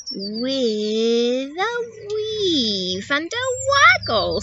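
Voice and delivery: slow, swooping slithery voice; slippery snake. Acted. Snake answering how she'll cross jungle, slow and slithery. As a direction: weave the pitch through long slow words.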